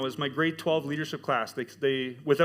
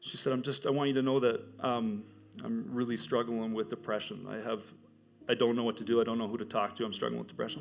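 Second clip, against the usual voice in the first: quiet confession; 3 semitones lower, 5 dB quieter than usual. Retold or imitated. Girl confides her depression.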